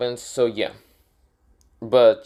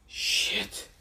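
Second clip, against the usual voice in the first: breathy whispered dread; whispered resigned curse; 6 dB quieter than usual. Acted. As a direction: hiss it under the breath in dread. Jedi sees the attack coming.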